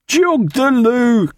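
very high, drawn-out shouted order; insistent cry. A narrator's reading. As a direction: holler it slowly across the boat. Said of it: "cried Mister Roke".

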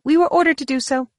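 high-pitched, abrupt interjection; cutting in. A narrator's reading. Woman interrupts Mara's question, cutting in. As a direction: jump in over their question, pitched high. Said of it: "the woman cut in".

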